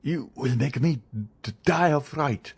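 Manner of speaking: stammering and stumbling over the first sounds in fear; terrified stammer. Acted. Caderousse to the Count, stammering in terror. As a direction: choke out the words, broken with fright. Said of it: "stammered Caderousse".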